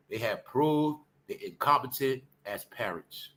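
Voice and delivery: normal talking voice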